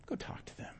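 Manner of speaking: soft whispered nudge. Retold or imitated. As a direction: whisper it like an inner prompting. God's voice prompting the speaker.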